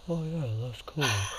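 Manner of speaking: soft muffled mumble behind a hand. Retold or imitated. Showing how the friend mumbles, muffled soft. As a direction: mumble it through your hand. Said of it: "put his hand over his mouth".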